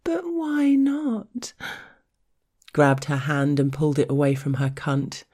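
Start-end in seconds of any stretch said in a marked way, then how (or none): 0.0-1.5 high, slow, mocking whine; mock whining. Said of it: "I imitated her whiny voice".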